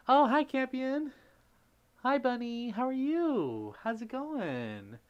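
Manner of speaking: high, bright squeal of hello, drawn out; excited greeting. Real, not acted. Streamer happily greets arriving viewers, squealing high and drawn out. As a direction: greet them like a long-missed friend.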